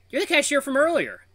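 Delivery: high, loud burst of surprise; excited recognition. Acted. Tiger spots the cashier he met, excited recognition. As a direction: call it out on recognising someone. Greeting someone recognised in story.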